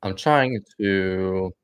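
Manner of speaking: talking while chewing gum; words round a wad of gum. Real, not acted. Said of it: "sorry for the fucking chewing".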